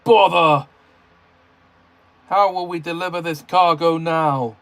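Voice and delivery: loud, annoyed outburst; cross. A narrator's reading. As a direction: snap it out in frustration. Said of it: "he said crossly".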